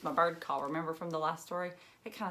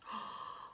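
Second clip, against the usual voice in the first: gasp; 12 dB quieter than usual. Acted. Surprised at chicks entering house.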